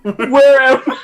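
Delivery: high, loud bewildered cry; hammed-up disoriented shout; mock panic. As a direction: play lost and panicking.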